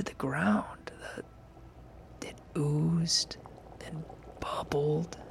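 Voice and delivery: whispered, tense storytelling; a nervous whispered build of dread; hushed scary narration. Acted. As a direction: breathe it out like a campfire horror tale. Whispered creature description.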